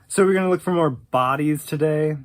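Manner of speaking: deadpan, low and quick sarcasm; sarcastic kid, quick and low. Retold or imitated. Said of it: "Very sarcastic".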